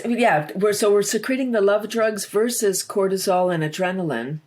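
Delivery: usual speaking voice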